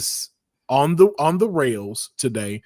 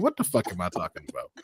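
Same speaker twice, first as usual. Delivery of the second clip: confused muttering; 8 semitones lower, 9 dB quieter, faster than usual. Retold or imitated. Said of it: "I had a T K moment".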